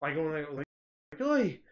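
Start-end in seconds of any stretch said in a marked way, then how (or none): 1.2-1.6 high, loud Irish "oi"; imitated Irish exclamation; Irish accent. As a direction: call out in a put-on Irish accent. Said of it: "speaking with an Irish accent".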